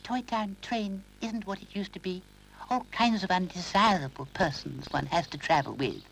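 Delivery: high, disdainful doll voice; snobbish doll. Acted. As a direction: look down your nose at everyone. Doll's snobbish voice.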